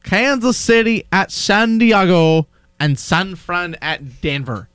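very slow, drawn-out dictation. Shown as a demonstration. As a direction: stretch every team name right out. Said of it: "Go slower".

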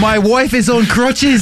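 high, slow British tourist; mock British accent. Imitating the driver with his wife, mock British accent. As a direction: draw it out in a hammed-up British accent. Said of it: "old guy with a British accent".

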